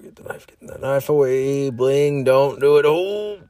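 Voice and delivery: rising, drawn-out cry of alarm; mock panic. Acted nightmare panic.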